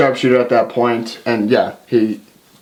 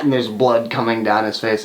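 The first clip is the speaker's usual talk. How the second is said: ordinary talk, nothing marked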